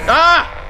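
sudden high yelp of fright; startled yelp. Real, not acted. Gamer yelping in fright at the screen. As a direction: jump at the scare, yelp. Scared by game creature.

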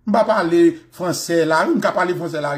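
ordinary delivery

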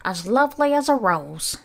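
high, singsong boasting in verse; self-adoring Aunt Sponge. A narrator's reading. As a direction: recite it grandly in a high, preening voice. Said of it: "Aunt Sponge declared".

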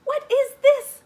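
high, loud outburst of disbelief; shocked cry. A narrator's reading. Mama finding food in her basket, shocked cry. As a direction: cry out in astonishment. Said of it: "cries Mama".

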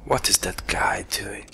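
hushed whisper, barely voiced. Real, not acted. Player whispering about the strange smoking man, hushed. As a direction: whisper it so he won't notice.

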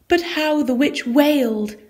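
a high storyteller's wail; wailing lift. A narrator's reading. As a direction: let the line rise into a wail. Witch grins, then wails.

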